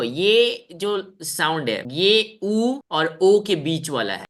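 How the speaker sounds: slowly stressing the sound; slow explanation. Shown as a demonstration. Teacher explaining a vowel to students, slow and careful. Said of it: "अब ये देखो".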